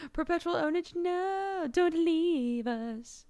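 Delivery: high, drawn-out mock plea. Streamer jokingly begging viewers to stay, high mock plea. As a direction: wail it slowly in a high put-on voice. Acted plea after plan remark.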